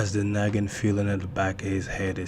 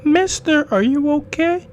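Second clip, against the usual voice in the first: put-on very high, loud little-girl voice; squeaky-high girl calling to a stranger; eerie little girl; 9 dB louder than usual. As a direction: pipe it up like a small child.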